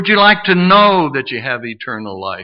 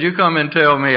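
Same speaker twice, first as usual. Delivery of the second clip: ordinary talk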